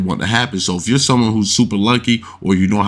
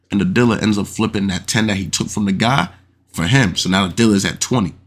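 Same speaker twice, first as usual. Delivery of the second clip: ordinary delivery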